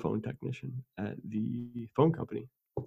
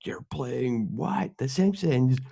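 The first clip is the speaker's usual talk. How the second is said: mock outraged mom, higher and drawn out; angry mom; 4 semitones higher, slower than usual. Son imitating his mom catching him with The Simpsons, mock outrage. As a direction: imitate mom's temper in slow, high disbelief. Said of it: "she had a temper, but she was like".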